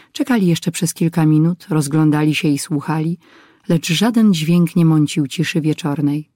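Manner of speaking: ordinary delivery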